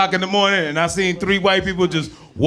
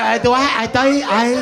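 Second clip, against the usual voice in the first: higher-pitched halting stammer; mock someone stumbling over English, pitched up; imitated poor English; 4 semitones higher than usual. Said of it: "act like you don't speak English".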